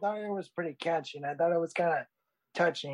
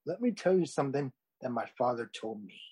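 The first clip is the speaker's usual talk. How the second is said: Mufasa; 3 semitones lower, 4 dB quieter than usual. Acted. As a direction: deliver it as a wise king teaching his son, softly and unhurried. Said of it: "you sound just like Mufasa from The Lion King. Very noble and wise".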